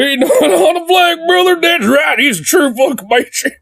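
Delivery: very high hammed-up patriot voice; mock idiot supporter. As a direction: cheer your hero on in a silly high voice. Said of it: "idiots like this be".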